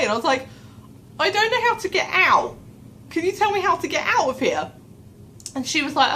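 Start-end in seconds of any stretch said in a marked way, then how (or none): 1.2-4.7 high-pitched, mock-desperate appeal; panicky plea. Lost visitor begging a woman for the way out, acted panicky plea. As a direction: plead helplessly, in a raised voice.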